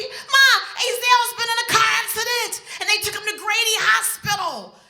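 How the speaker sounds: high, loud, fast panicked report; frantic. Retold or imitated. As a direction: blurt it in terror. Son frantic with bad news.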